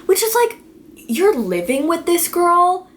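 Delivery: can't believe what she's reading; incredulous. Real, not acted.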